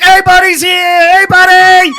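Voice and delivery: high, loud, drawn-out greeting yell; excited shout. Retold or imitated. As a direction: hail him across the street like rowdy teens. Kids hailing Frankie from car.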